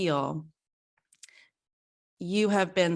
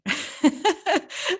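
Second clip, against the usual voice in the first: bright burst of laughter; a quick high giggle at her own story; 11 semitones higher than usual. Real, not acted.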